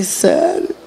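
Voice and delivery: whispered, stunned; a hushed retold gasp of disbelief; breathy whisper of disbelief. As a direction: breathe the word out in shock. Reacting to colonoscopy news.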